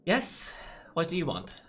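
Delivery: high, put-on posh British accent; a fussy British officer answering the door, posh accent. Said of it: "Like a posh ac-, accent?".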